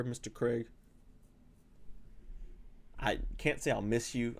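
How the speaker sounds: usual speaking voice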